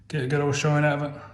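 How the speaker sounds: ordinary talk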